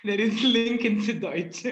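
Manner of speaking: words spilling through real laughter, slowed; laughing. Real, not acted. Guest joking with the audience, laughing aloud.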